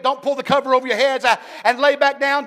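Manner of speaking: ordinary delivery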